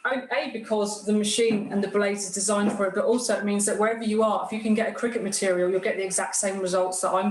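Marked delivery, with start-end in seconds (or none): none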